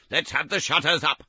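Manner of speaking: loud, high, hearty call; a jolly master rallying his boys; jovial Fezziwig. Acted. Fezziwig to his apprentices, loud and jovial. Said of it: "cried old Fezziwig".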